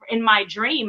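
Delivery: normal talking voice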